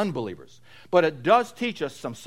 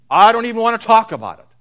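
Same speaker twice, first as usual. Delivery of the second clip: dismissive quote; 6 semitones higher, 9 dB louder, faster than usual. Retold or imitated. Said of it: "Have you ever heard that?".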